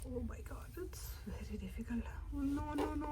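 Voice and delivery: fast hushed whisper. Real, not acted. Kid whispering mid-pull, fast and hushed. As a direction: murmur it under your breath, tense.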